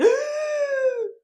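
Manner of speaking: very high drawn-out mock gasp; shocked gasp. Stranger offended by the question, high mock-shocked gasp. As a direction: squeal in a long, offended breath.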